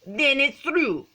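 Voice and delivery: high, loud, slow pronouncement; decreeing. A narrator's reading. Don Diego rules on the password, slow grand decree. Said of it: "decreed Don Diego".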